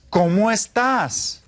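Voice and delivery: high, slow ¿Cómo estás? for repeating; slow, clear model phrase. Shown as a demonstration. Teacher modelling ¿Cómo estás? for students to repeat. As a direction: say it slowly so the class can copy. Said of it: "Repitan todos".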